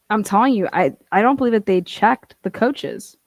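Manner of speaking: normal talking voice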